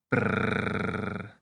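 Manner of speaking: a rolled-lip brrr like someone shivering. Shown as a demonstration. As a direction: make a cold shivering brrr. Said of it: "make a noise like you're shivering".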